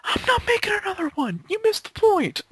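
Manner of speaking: very high, exasperated yell; exasperated shout. Retold or imitated. Hack maker snaps back at a fan, very high. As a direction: throw it out in frustration. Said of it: "is like".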